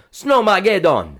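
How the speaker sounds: Snowmageddon in a Jamaican lilt; high and slow Jamaican accent. Shown as a demonstration. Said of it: "helps you with your Jamaican accent 'cause you can go".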